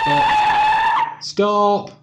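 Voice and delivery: a huge, high, comic shout of stop; sudden shout. Real, not acted. Stopping the talk to replay Brian's reading, shouting. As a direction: cut everyone off with one loud yelp. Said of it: "interrupts herself to replay Brian".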